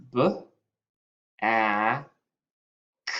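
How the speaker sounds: "B, A, K" as separate letter sounds with long gaps; sounded out. Shown as a demonstration. Teacher writes the first syllable, sounding out B, A, K as isolated sounds. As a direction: sound out B, A, K one at a time. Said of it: "I'm gonna write the first syllable".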